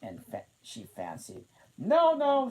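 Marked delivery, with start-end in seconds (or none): 1.8-2.5 high, loud protest; the Queen crying no; cried out. A narrator's reading. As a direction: cry it out sharply. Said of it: "she cried".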